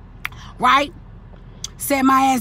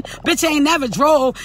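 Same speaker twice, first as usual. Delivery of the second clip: ordinary delivery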